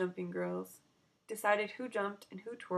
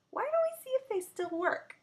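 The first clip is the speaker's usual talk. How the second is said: much higher, teasing offer; playful mom; 4 dB louder than usual. Acted. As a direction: dangle the surprise.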